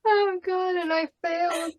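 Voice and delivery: high, teary laughing voice; laughed so hard the tears come; crying from laughter. Real, not acted. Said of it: "And now I'm crying".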